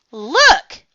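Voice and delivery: high, loud excited shout; yell. A narrator's reading. Froggy at the volcano, excited yell. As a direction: shout it, pointing something out. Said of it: "yelled Froggy".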